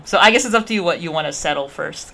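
ordinary talk, nothing marked

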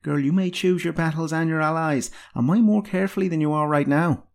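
put-on bossy old mentor giving orders; ordering. A narrator's reading. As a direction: put a young apprentice in her place. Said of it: "ordered the healer".

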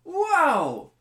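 high, loud gushing amazement; excited infomercial customer. Acted. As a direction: gush like a TV ad buyer.

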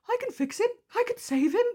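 high, far, mock-swooning voice; lovestruck inner voice. Host voicing her inner romantic about Byron, high mock swoon. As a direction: play the hopeless romantic inside. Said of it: "someone in me going".

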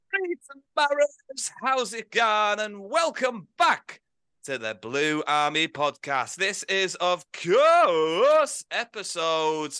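slow, drawn-out announcer welcome; podcast host intro. Acted. Host welcoming listeners to the podcast, announcer style. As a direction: welcome listeners like a big show.

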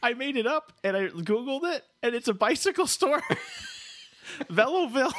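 high, laughing giddy reveal; can't hold back the joke, bubbling up high. Real, not acted. Host gleefully tells Ryan the town was a bike store, high and giddy.

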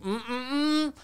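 high, soft closed-mouth refusal hum; mock wordless whining; sulky child. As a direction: imitate a child refusing to speak. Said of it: "like an eight-year-old".

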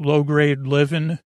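ordinary talk

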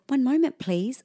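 polite stalling receptionist, imitated; unhurried nurse. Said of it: "she said".

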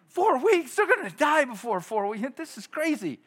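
high, loud disbelieving squawk; incredulous shock. Retold or imitated. Preacher retelling his shock at a four-week wait, high and loud.

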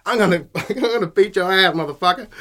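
high, loud mock threat through a laugh; hammed-up would-be tough guy; tough American dude. Angry shopper threatening the agent, hammed-up tough-guy shout. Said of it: "overweight American dudes that think they're tough".

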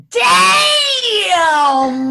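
high, loud startled damn; shocked shout. Retold or imitated. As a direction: blurt it out stunned. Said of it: "And the guy goes".

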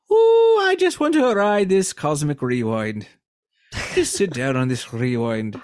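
put-on Irish accent. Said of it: "saying rewind in an Irish accent".

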